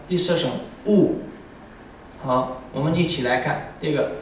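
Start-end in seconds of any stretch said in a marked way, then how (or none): 0.9-1.2 乌 falling sharply; fourth tone. Shown as a demonstration. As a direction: drop 乌 hard.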